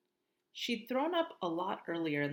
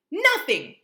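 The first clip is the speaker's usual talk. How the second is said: loud high curt "Nothing"; snapped; 11 semitones higher, 15 dB louder than usual. Acted. Mom and Via snap at Augie together. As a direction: snap it shut. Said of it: "they both snapped".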